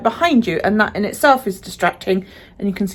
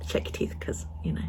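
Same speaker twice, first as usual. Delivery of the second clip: whispering; 14 dB quieter than usual. Real, not acted. Unvoiced aside.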